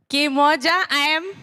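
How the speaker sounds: high, loud call-out to the crowd; excited call. Real, not acted. Speaker greeting students, high excited call. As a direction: call out to rouse them.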